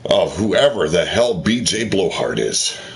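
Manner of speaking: usual speaking voice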